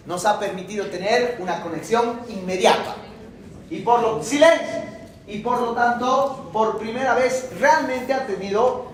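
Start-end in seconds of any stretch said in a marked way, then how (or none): 4.2-4.8 sudden higher-pitched call to order; calling for silence. Real, not acted. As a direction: cut in to hush the class. Lecturer breaks off to hush class.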